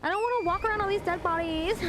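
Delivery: high ditzy airhead voice with a giggle; dumb blonde voice. Pretend. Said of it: "Someone redeemed dumb blonde voice ... talk like this".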